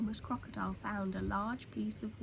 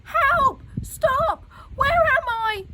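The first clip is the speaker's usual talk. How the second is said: high, much louder panicked yells; tumbling crocodile crying out, dazed; dazed loud cries; 13 dB louder, much slower than usual. A narrator's reading. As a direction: holler like you're tumbling and confused. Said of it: "cried the crocodile".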